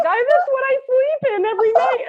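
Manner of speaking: high, amazed disbelief; astonished student. Retold or imitated. Student stunned at the shirt, high astonished voice. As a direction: squeal it out in shock. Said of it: "and they're like".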